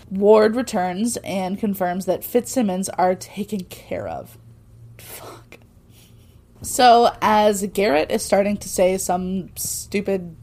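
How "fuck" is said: hushed, toneless whispered curse; whispered swear. Real, not acted. Podcaster swearing under her breath at the plot, whispered.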